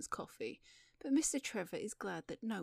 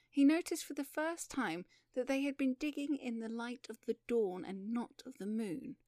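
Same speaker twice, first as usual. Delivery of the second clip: ordinary delivery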